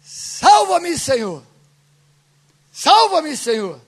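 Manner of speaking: high, loud opening that drops on the last word; clamor gritado. Acted. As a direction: cry out for rescue, each plea falling away. (clamor por socorro, gritado)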